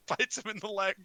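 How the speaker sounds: ordinary talk, nothing marked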